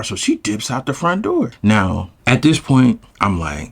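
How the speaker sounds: ordinary talk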